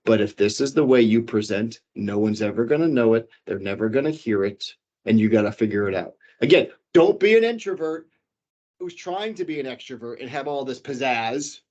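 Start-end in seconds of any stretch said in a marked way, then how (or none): 0.1-6.1 dull low delivery. Shown as a demonstration. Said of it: "don't just talk like this the whole time".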